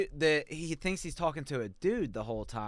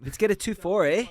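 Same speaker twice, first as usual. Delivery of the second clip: louder mock Canadian cheer; 5 semitones higher, 4 dB louder than usual. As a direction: hype it like a hoser. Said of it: "that's Canadian".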